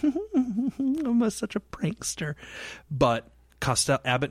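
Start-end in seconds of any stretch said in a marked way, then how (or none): 0.0-2.4 a hint of a sly, giggly rascal; Errol Flynn. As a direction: play the pleased prankster. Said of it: "Errol Flynn's like".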